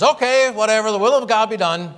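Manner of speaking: "Okay, whatever, the will of God be done" high and loud, tossed off carelessly; dismissive shrug. Retold or imitated. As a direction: throw up your hands and brush it off. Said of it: "This is not just a throwing up of the hands".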